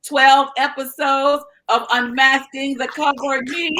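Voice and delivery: building to a high, loud proclamation; proud announcement. Real, not acted. Proclaims host's congratulations to audience.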